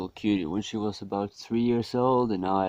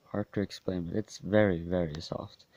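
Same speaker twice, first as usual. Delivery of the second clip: usual speaking voice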